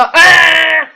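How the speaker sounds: sudden loud mock metal scream; imitating a deathcore vocalist's scream; death metal scream. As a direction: belt one short loud growling scream, tongue in cheek. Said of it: "Is deathcore the one where they go".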